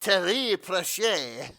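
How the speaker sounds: "Terry Pratchett" as teh-REE pruh-SHAY, high, loud, drawn out; hammed-up French "Pratchett", ending in SHAY; mock French pronunciation. Mock French version of the name.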